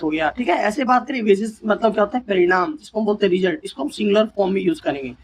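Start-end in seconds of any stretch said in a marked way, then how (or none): none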